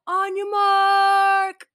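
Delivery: high, loud, stretched-out holler; loud starting-line yell, held long; drawn-out battle call. Retold or imitated. Retold ready-to-fight call on seeing post.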